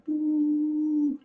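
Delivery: high sudden boo sound; a sharp, high comic boo into his arm; mock energy blast. Telling the Aikido master's story, a high mock boo. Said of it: "putting my finger on here and going".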